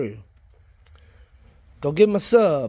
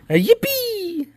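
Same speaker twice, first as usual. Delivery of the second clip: high, slow, stretched yippee; drawn-out yippee; 13 semitones higher, much slower than usual. Real, not acted. Gardener enjoying the sound of yippee, slow and high. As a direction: savor every bit of yippee. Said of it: "say it over and over 'cause you like the way it sounds".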